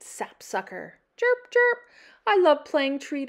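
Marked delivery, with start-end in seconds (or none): 1.2-1.8 high chirping bird noise; high bird call. Acted. Imitating a bird.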